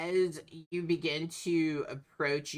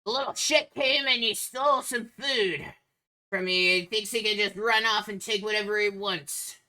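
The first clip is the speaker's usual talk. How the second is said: louder, higher, aggrieved ogre voice; yelling ogre; 6 semitones higher, 5 dB louder than usual. Acted. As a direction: rant loudly as an angry ogre. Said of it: "you can hear the ogre yelling".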